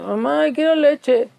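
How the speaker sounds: put-on high, slow, pleading child voice; little daughter's voice. Mother imitating her sleepy-time daughter asking for milk. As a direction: act out a small girl whining for milk. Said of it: "tu hija te dice".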